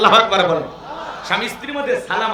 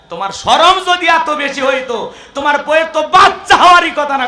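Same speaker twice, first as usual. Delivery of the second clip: raised, louder teasing rebuke; preacher mocking a shy husband, louder; mock scolding; 7 semitones higher, 8 dB louder than usual. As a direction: scold him loudly for the joke.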